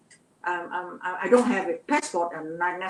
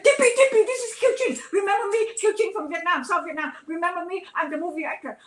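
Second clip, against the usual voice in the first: much higher, pleading call; calling the actress with a last hope, high and pleading; desperate plea; 9 semitones higher, faster than usual. Retold or imitated. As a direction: beg her to remember you. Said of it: "I said".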